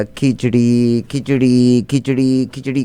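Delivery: slow chant of khichdi, fading as it goes; mumbling a word over and over; repeating to memorize. Acted.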